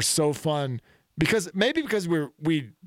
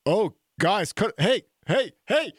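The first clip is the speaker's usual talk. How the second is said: higher, lightly mocking voice of a lost quarterback; high-pitched dazed quarterback; 7 semitones higher, slower than usual. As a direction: play a clueless QB calling for a stop. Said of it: "and he's like".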